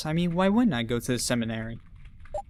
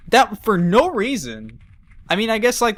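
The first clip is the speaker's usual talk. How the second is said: ordinary talk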